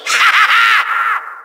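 short burst of villainous laughter; evil laugh. Acted. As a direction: cackle like a triumphant villain. Villain gloats over no mercy.

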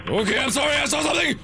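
high, panicky yelp; startled panic. Real, not acted. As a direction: blurt it out in a high-pitched fright. Startled by something in horror game.

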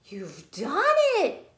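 high, loud cheer; excited shout. Acted. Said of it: "shouted Fish".

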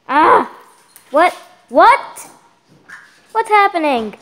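loud, high startled outburst; yelp, then question it in rising disbelief; alarmed disbelief. Real, not acted. Child gamer reacting to sudden glitch, startled outcry. Game suddenly goes wrong.

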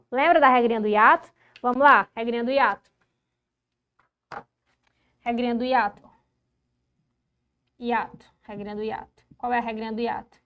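ordinary delivery

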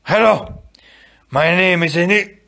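high, loud greeting breaking off mid-name; wounded man's vow cut off. Acted. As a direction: start the vow and get stopped short. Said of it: "what he actually got out was somewhat less".

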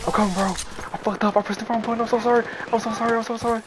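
frantic apology. Real, not acted.

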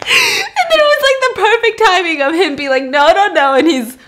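can barely get it out for laughing; real laughter, high and loud. Real, not acted. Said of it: "I thought you genuinely were having a reaction".

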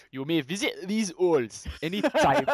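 slow, badly faked Geordie; terrible Geordie accent. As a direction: attempt Geordie and get it wrong. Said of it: "That's a terrible Geordie accent".

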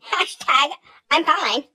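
put-on high, loud gushing girl voice with a giggle, slowing at the end; bubbly girl impression. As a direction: ham up the over-excited waitress. Voicing the waitress again.

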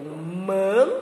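"man" held with a nasal n at the end. Shown as a demonstration. Teacher showing children the sound of final N, nasalised. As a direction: let "man" close on a hummed n. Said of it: "o N tem esse som de n".

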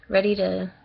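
ordinary delivery

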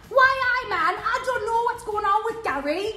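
high, exaggerated Geordie imitation; mock Geordie impression. Friend mimicking pal on Geordie Shore, mock Geordie. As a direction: send up a Geordie reality star in high pitch. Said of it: "it was just you going".

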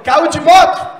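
loud, high blurted question; loud acted disbelief; mock startled question. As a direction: blurt it out like a shocked kid. Kid reacting to his limp.

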